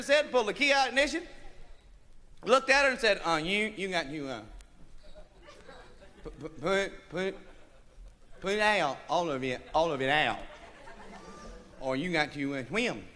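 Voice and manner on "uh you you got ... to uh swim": softer, lower halting stutter; nervous stammer. Acted. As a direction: trip over every word. Shy man stammering an ultimatum.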